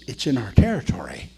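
hushed whisper. Retold or imitated. Reader realises the accident is nearby, whispering. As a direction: breathe it with concern. Reading news quietly.

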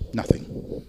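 whispered, barely there; a sheepish whisper of an answer; whispered nothing. Retold or imitated. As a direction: breathe the word out with no voice, ashamed. Boy admits no sales.

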